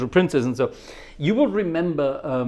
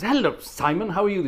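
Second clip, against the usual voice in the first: higher-pitched cheery hello; choir greeting; 6 semitones higher than usual. Acted. Choir leader greeting Simon at practice, higher-pitched acted greeting. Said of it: "when you go to choir practice".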